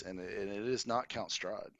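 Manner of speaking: ordinary talk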